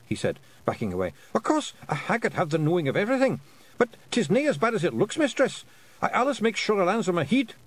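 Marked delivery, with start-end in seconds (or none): 1.3-7.5 high, anxious Scottish pictsie; frightened pictsie pilot. A narrator's reading. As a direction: placate her in a high Scots voice. Voices frightened pilot backtracking.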